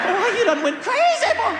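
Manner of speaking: loud, high-pitched mother's holler; outraged. Retold or imitated. Mother to her son in church, outraged yelling. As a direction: yell in furious disbelief at a child.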